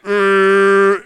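long, loud droning "errr"; loud revving noise. Acted. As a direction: imitate a machine cranking up. Imitates the heat being turned up.

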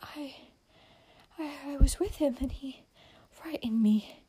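high, hushed, halting fright; frightened stammering half-whisper; gasping. A narrator's reading. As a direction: gasp it out in broken pieces. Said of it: "gasped Amy".

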